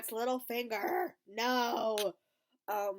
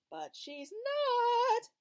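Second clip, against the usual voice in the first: much higher and slow on not; drawn-out emphasis; 7 dB louder, much slower than usual. Real, not acted. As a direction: stretch it out teasingly. Dramatic reveal to viewers.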